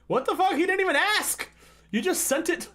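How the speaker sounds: shocked protest at the screen; outraged disbelief. Real, not acted. Outraged reaction to reading.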